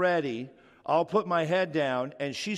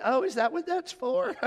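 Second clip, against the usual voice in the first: high mock-innocent voice, far higher; wife's innocent reply; 14 semitones higher than usual. Preacher mimics wife feigning surprise about perfume, high and innocent. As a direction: play dumb in a high wife voice. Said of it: "Debbie replies".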